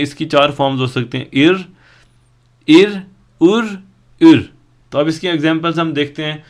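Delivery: normal talking voice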